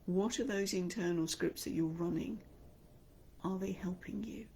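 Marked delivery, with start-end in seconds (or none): 3.4-4.5 whisper. Real, not acted.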